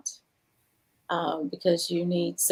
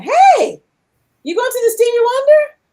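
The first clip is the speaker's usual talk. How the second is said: higher, louder friendly call across the table; husband at dinner invites her, loud and high; loud cheerful call; 11 dB louder than usual. Retold or imitated.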